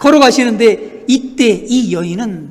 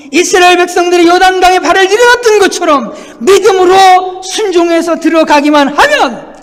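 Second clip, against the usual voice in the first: high, loud, sustained sermon cadence; impassioned preaching; 7 semitones higher, 7 dB louder than usual. Real, not acted. Preacher rousing the congregation to step out in faith, impassioned. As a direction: build up and drive each phrase home.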